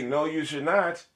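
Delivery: ordinary talk, nothing marked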